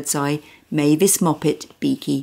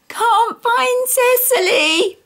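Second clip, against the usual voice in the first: high, slow tearful wail; wailing little girl; slower than usual. Acted. Small girl crying over her lost carrot doll, wailing. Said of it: "she wailed".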